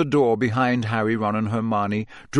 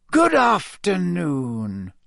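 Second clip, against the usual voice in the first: very slow, genial greeting; pleasantly; 6 semitones higher, much slower than usual. A narrator's reading. Headmaster greeting the surprised children, slow and pleasant. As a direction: greet them kindly, unhurried. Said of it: "he said pleasantly".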